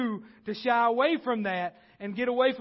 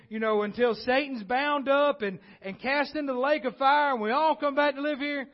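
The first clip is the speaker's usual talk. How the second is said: raised, louder preaching voice; fervent pulpit build; preaching at full force; 4 semitones higher, 3 dB louder than usual. Acted. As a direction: build the sermon with rising fire.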